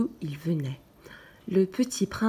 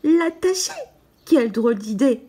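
louder, higher outraged question; shocked; 5 semitones higher, 6 dB louder than usual. A narrator's reading. The little prince, shocked by the idea of tying up his sheep, louder and higher. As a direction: recoil at the very idea. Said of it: "parut choquer le petit prince".

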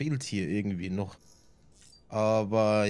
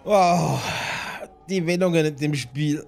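excited; 5 semitones higher, 7 dB louder than usual. Real, not acted. Streamer reacting to plot twist.

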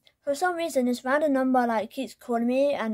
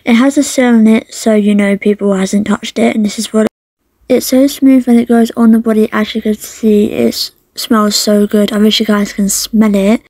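loud, bubbly product pitch; ad read; 18 dB louder than usual. Acted. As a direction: sell it like a beauty ad.

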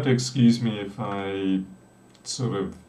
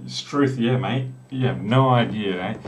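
put-on awkward Australian accent; 3 dB louder than usual. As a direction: play up a fake ocker Australian accent. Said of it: "awkward Australian voice overlay".

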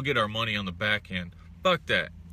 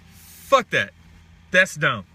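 loud dismissal; 2 semitones higher, 7 dB louder, slower than usual. Real, not acted. Angry dismissal after rant.

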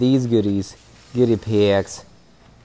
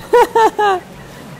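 high giggle. Real, not acted. Laughing at opponents.

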